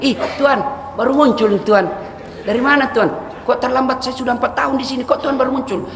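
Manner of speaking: high, chirpy reproach; mock-surprised Inem; teasing maid. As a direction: needle the late master. Inem greets late master in heaven.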